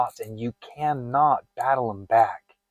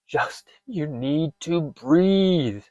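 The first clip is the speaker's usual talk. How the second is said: higher, slow, strained self-plea; staged panic; 6 semitones higher, much slower than usual. Pretend. Coach faking a panic attack for viewers, strained plea. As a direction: beg yourself to breathe mid-panic. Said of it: "I'm giving myself a fake panic attack".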